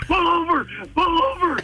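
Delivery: high, mildly frantic retold cry; urgent plea. Puking buddy to driver, urgently begging. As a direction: imitate someone desperate to stop the car.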